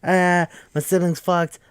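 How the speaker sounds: high-pitched mock horror, grossed out; hammed-up wailing freak-out. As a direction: play a sibling utterly disgusted and panicking. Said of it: "It's just freaking out".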